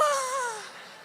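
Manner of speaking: high, loud mock wail; hammed-up loud "ah"; dismayed wail. Reaction on hearing car is financed.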